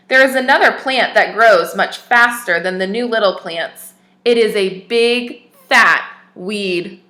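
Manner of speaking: clear, even storybook reading voice; story narration. Acted. Teacher reads a picture book to children, storybook narration. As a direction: read the tale aloud to little listeners.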